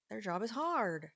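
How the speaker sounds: ordinary talk, nothing marked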